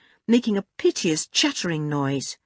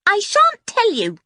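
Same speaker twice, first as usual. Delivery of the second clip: put-on very high, loud child's refusal; defiant small boy; 6 dB louder than usual. A narrator's reading. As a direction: refuse point-blank in a piping voice.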